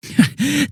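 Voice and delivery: short high scoffing laugh; mocking laugh. Villain scoffing at the hero, short high laugh.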